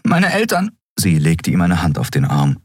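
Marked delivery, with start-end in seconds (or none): 0.0-0.8 higher pleading voice; lightly voiced worried figure, pitched up; anxious young character. Figure's lines in audiobook.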